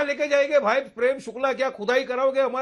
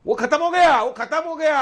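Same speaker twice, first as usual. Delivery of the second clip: louder, higher insistent rebuttal; emphatic retort; 5 semitones higher, 5 dB louder than usual. Real, not acted. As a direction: cut in firmly and repeat it forcefully. Heated retort to anchor's challenge. (ज़ोर देकर ऊँची आवाज़ में पलटवार)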